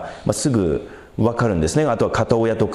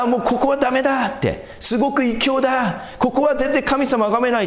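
complaining whine; 12 semitones higher than usual. Retold or imitated. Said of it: "っていうふうに不満を言ったら".

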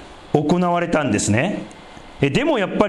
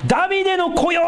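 imitating the blind men calling to Jesus, raised and high; mock shout; 12 semitones higher than usual. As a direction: call out to the Messiah. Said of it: "盲目の人が二人叫んでます".

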